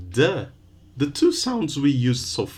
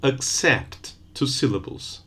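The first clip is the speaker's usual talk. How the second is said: normal talking voice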